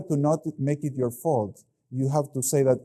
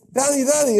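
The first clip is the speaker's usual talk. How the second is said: high, louder imitation of a little kid; excited little-kid voice, pitched way up; small child calling; 12 semitones higher, 6 dB louder than usual. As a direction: call out like a child to a parent. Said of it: "they start speaking like this".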